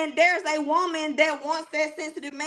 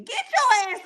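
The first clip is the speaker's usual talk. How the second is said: high, sharp order to get up; sharp retold command; 10 semitones higher than usual. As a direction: snap at the husband to get up. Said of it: "as your wife".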